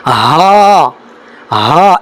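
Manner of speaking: ہا breathed out from the start of the throat; airy model of ہا; breathy letter demo. Shown as a demonstration. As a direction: exhale each ہا. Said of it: "اگلا حرف کیا ہے".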